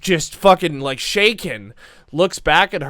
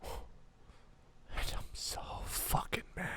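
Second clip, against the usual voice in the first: very slow, barely voiced whisper; angry whisper; 4 semitones higher, 20 dB quieter, much slower than usual. Scared kid admitting anger, slow whisper. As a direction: whisper it slowly, word by word.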